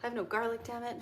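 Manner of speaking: usual speaking voice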